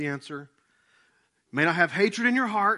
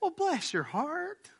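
high, drawn-out Southern sweetness; sugary sweet; 11 semitones higher, much slower than usual. Retold or imitated. Longtime Southerner greeting a newcomer, sugary sweet. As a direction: stretch it out, syrupy and high-pitched. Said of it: "they sound so sweet to you".

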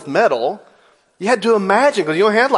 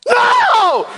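high, loud yelled no; a sudden high-pitched cry of horror; sound effect shout; 5 dB louder than usual. Acted. Preacher acting out the comic scene, shrieking no. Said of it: "I'm just sorry, sound effects".

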